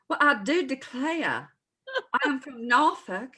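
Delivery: high, slow Southern belle drawl. Shown as a demonstration. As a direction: put on a prissy Southern lady accent. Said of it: "You have to say".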